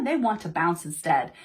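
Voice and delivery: ordinary delivery, nothing marked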